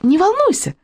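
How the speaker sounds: put-on high, cheerful young heroine's voice; cheerful interjection. A narrator's reading. Kamikadze reassures the others about the way back, cheerful. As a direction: chime in cheerfully, pitched well up. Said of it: "весело встряла Камикадзе".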